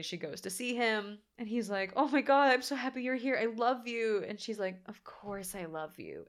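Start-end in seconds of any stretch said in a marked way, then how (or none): 1.9-4.2 higher-pitched, smitten gushing; mock adoring gush. Imitates clingy man overjoyed to see her. 4.9-6.3 soft, slow, joyless acted reply; imitated miserable reassurance. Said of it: "And she looks miserable".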